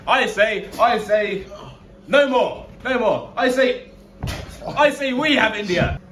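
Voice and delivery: hammed-up ranting statesman; loud high pompous bluster. Pompous ranting prime minister.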